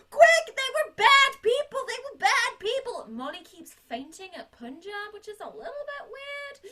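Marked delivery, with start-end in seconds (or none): 0.1-3.1 put-on urgent kids. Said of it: "they're like".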